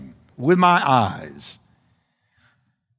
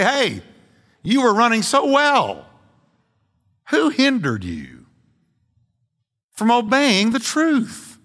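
higher, urgent questioning of a straying friend; pleading pastor to a backslider; 5 semitones higher than usual. Acted. Pastor confronting a friend met on the street, pleading questions. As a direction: plead with him, what happened to you. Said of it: "I could easily say".

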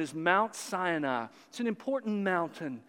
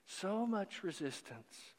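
soft sigh; 12 dB quieter, slower than usual. Acted. Lament repeated softly.

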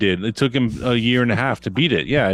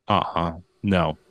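put-on lower, softer, slow kid's refusal; mock sulky refusal; 7 semitones lower, 5 dB quieter, slower than usual. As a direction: drag it out like a stubborn kid, low and quiet. Dad imitating son refusing.

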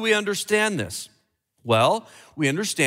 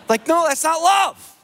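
much higher and louder no; loud emphatic no; 11 semitones higher, 5 dB louder than usual. Real, not acted. Preacher loudly rejecting the poisoning wife's love. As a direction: burst out rejecting it.

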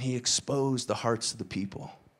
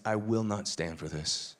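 sulky refusal; 9 semitones lower, 8 dB quieter than usual. Pretend. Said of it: "He says".

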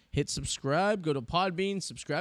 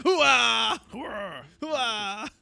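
loud gung-ho soldier; 6 semitones higher, 7 dB louder, much slower than usual. Retold or imitated. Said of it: "he's like".

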